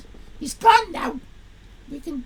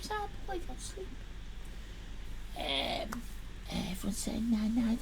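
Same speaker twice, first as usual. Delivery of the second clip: normal talking voice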